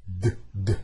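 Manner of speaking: "D, D" as two short, low d-d bursts; D sound alone. Shown as a demonstration. Teacher isolating the D for students, short low sounds. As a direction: tap out "D, D" as bare hard d sounds. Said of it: "Olha a diferença do D".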